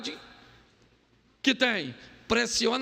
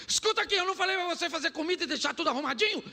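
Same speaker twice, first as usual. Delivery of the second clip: mock angry wife; 9 semitones higher, much faster than usual. Staged quarrel, wife's part.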